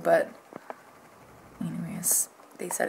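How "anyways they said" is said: whisper. Real, not acted. Trailing off.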